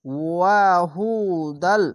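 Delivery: slow recited ওয়াহুদাল; Quran recitation. Shown as a demonstration. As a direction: intone ওয়াহুদাল slowly. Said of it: "recited Quran word". (তিলাওয়াতের সুরে)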